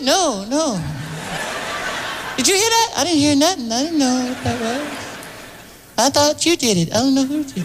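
high innocent [name] denial; [name] impression. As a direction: play dumb in a high voice. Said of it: "Michael denying".

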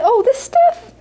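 high squeaky dismissive oh; mock surprised mother. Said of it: "And she'd say".

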